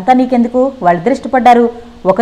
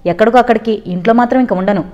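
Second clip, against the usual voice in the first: plain reading voice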